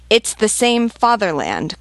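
high, loud, drawn-out exclamation; crying out. A narrator's reading. Sergeant correcting the woman about her country, crying out. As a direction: cry it out slowly and forcefully. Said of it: "cried the sergeant".

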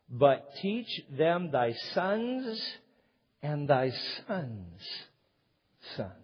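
slow, solemn Bible reading; drawn-out reverent reading; scripture reading. Acted. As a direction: read the verse with weight. Scripture read aloud.

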